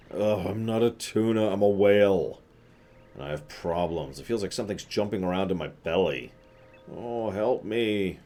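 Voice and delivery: suffering whale. Acted. Whale telling the explorer of his belly trouble, plaintive. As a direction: protest and plead as an ailing beast.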